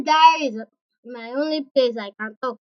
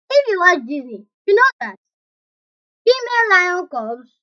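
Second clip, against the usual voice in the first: plain reading voice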